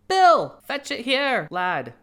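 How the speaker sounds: high, loud call to a workman. Acted. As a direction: yell across the yard to Bill. Workman calls to Bill.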